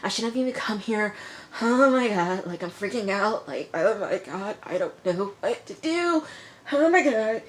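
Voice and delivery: high, mock-panicked rambling; imitating freaking out. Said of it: "she's freaking out".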